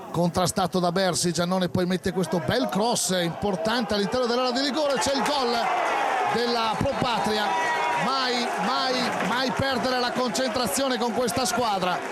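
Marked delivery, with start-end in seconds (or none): 1.3-12.0 rising excited goal call; breathless italian goal commentary. Acted. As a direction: shout the goal, high and urgent.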